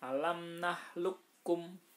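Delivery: "nahlukum" with the qaf dropped and the kaf doubled; "nahlukum" read slowly as tajwid, k sound held double; merged qaf into kaf. Shown as a demonstration. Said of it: "kofnya dihilangkan, kafnya ditasdidkan".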